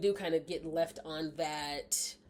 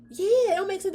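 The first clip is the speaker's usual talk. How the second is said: high, loud townsfolk yelling; imitating crowd; 9 dB louder than usual. Crowd turning on the prisoner, yelling high and loud. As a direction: play the angry villager, high.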